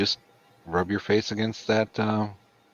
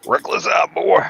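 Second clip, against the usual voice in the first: excited; 12 semitones higher, 9 dB louder than usual. Pretend. Mock excited reaction.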